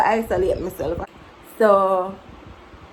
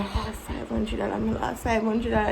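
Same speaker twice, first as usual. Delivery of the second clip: sighing mother; 7 dB quieter than usual. Retold or imitated. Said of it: "She a say".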